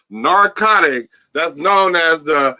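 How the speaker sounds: very slow, each name stretched out; slow, drawn-out roll call of names; drawn-out billing. Real, not acted. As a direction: announce it word by word, with long pauses. Drawn-out mock billing of the team.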